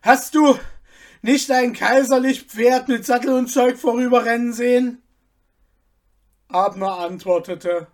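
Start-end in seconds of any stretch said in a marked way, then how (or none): 0.0-5.0 high, loud, breathless asking; urgent loud question. A narrator's reading. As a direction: demand an answer in a hurry. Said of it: "rief ihm keuchend der Oberstallmeister zu".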